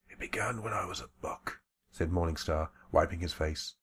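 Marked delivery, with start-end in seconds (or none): 0.1-1.6 put-on weak whispered old man voice; breathless rasping whisper, barely alive; frail old man whispering. Old shaman tells his story.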